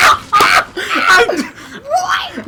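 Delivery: shrieking high laugh, voice cracking; helpless laughter. Real, not acted. Breaking down while retelling the stranger's advice, shrieking laugh. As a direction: lose it completely.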